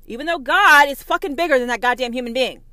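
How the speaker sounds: loud, fast raging yell; furious shout. Real, not acted. Preacher raging at believers, loud fast yell. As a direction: blast it out in fury. Ranting against the Christians.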